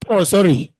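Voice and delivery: ordinary delivery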